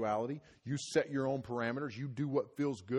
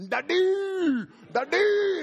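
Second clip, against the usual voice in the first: put-on very high, loud child's call of Daddy; high loud little-kid cry for Daddy; toddler calling daddy; 11 dB louder, much slower than usual. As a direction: squeal Daddy like a toddler in the crib.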